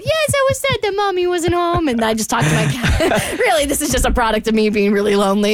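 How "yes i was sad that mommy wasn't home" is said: high, sad little pet voice; cat voice. Retold or imitated. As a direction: answer as the cat, a bit sad. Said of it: "I do this at home with my cats too".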